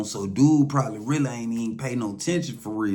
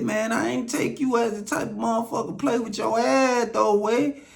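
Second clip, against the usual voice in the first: incredulous; 12 semitones higher than usual. Retold or imitated. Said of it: "I said".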